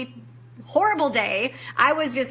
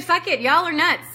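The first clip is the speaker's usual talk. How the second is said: higher-pitched exasperation; reenacted declaration of quitting; retold fed-up outburst; 5 semitones higher, 4 dB louder than usual. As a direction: throw it all in, fed up.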